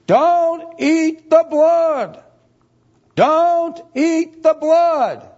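high, loud, very slow preaching; thundering commandment. Acted. Preacher commanding congregation, very slow loud. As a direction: pound out each word.

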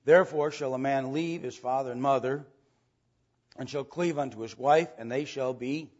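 normal talking voice

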